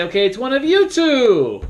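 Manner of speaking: high, sing-song teasing announcement; teasing moderator. Shown as a demonstration. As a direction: tease the last two suspects. Moderator narrowing down suspects.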